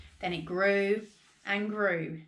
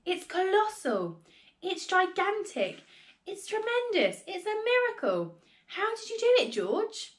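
very high, ecstatic voice; excited exclaiming; 10 semitones higher than usual. A narrator's reading. Father dancing round the hen, very high excited exclaiming. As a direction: pile up superlatives in mounting delight. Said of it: "Mister Cranky shouted".

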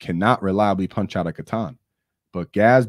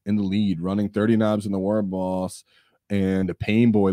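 usual speaking voice

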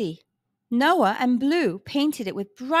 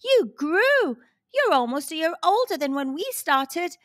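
higher, delighted exclaiming; excited cry; 6 semitones higher than usual. A narrator's reading. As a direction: cry out with glee. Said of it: "cried Noah".

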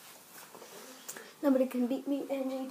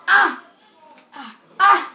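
short loud yelps through a full mouth; muffled yelp; 3 semitones higher, 9 dB louder, slower than usual. Real, not acted. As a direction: yelp with cheeks stuffed.

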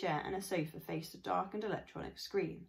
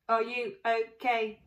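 robot voice; 4 semitones higher, 7 dB louder, slower than usual. Acted. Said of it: "she said".

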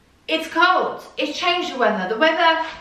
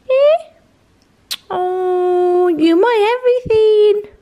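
much higher and louder, cooing to the dog; 10 semitones higher, 5 dB louder than usual. Real, not acted. Owner cooing to her dog she'll miss, high baby talk.

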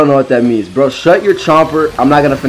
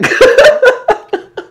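high squeal laugh; 3 dB louder than usual. Real, not acted. High laugh after joke.

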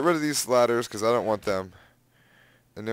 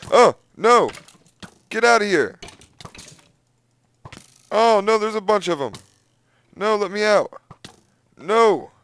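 panicked yelling; 6 semitones higher, 4 dB louder than usual. Real, not acted. Swarmed by enemies.

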